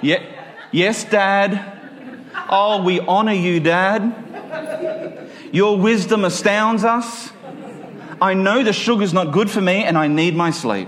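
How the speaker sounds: a child buttering up Dad, hammed-up and sarcastic; sarcastic flattery. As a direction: lay the flattery on thick, grinning.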